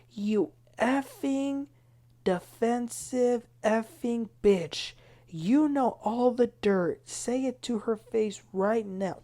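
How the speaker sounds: slow, deliberate mock-furious insult. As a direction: lean on every word, half-hammed. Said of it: "Heather surprised me because she said".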